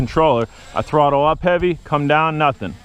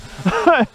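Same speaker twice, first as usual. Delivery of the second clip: quick amused laugh; short laugh; 12 semitones higher than usual. Real, not acted. Laughing at the flies.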